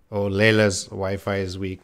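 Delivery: ordinary delivery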